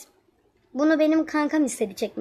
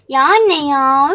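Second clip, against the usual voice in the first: put-on drawn-out, louder whine of a grumpy child; whiny protest; 8 dB louder, much slower than usual. Daughter whining at mum to stay in bed, slow and loud. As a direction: stretch it out like a kid who won't get up.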